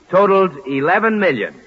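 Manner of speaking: normal talking voice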